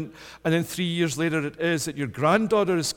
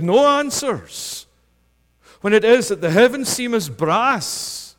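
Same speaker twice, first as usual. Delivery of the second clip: raised, loud pulpit preaching; fervent sermon climax, loud; impassioned preaching; 6 semitones higher, 7 dB louder than usual. Acted. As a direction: drive it home to the congregation. Preaching at full force.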